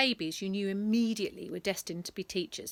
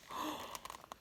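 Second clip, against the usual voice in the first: gasp; 13 dB quieter than usual. A narrator's reading. Gasp at demolition ball.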